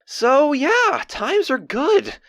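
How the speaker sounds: high, drawn-out elation; happy excitement. Acted. Diarist saying life is going well, elated.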